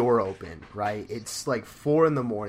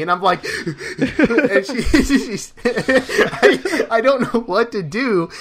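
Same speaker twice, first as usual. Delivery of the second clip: higher and louder, words broken by laughter; can barely get it out for laughing; laughing while telling; 11 semitones higher, 10 dB louder, slower than usual. Real, not acted. Laughing while retelling the panic.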